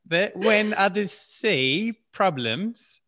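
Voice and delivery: slow, high-pitched mock northern English accent; attempted northern English accent. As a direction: have a go at northern English, stretching every vowel. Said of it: "in northern accent".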